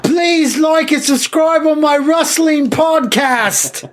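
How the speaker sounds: wrestler promo voice. Pretend.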